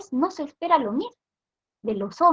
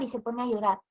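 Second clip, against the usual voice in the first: fading repetition; 7 dB quieter, faster than usual. Shown as a demonstration.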